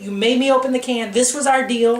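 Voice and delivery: retold furious shout; angry dad shout. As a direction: bark it like a dad whose patience just ran out. Dad angrily insisting on deal.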